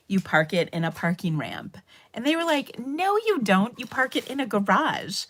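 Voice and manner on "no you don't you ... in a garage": high-pitched mock contradiction; teasing correction. Students correcting their teacher's word, high mock protest. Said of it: "made fun of me".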